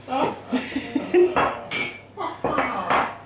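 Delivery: long giggling laugh. Real, not acted. Baker compares herself with chefs worldwide, laughing. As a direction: laugh at your own slapdash work.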